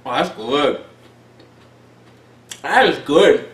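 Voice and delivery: speaking between bites; talking while chewing. Real, not acted. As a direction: mouth partly full of cookie. Seen 0: Bites cookie at -4, jaw still working while speaking.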